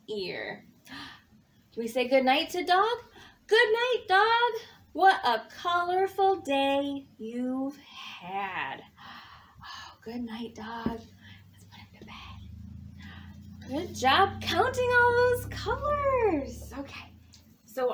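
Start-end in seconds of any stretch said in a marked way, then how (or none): 3.5-4.6 high, bright sing-song farewell; cheery good night. Acted. Storyteller bids dog good night. 9.6-13.9 hushed bedtime whisper; whispered good night. Acted. Tucking dog into bed softly.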